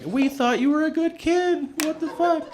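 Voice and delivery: hammed-up parental disbelief; shocked parents. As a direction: play the stunned parents in falsetto.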